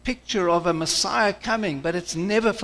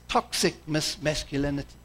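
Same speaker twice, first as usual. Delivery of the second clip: mocking critics; 4 semitones lower, 6 dB quieter than usual. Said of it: "frowns on today and says".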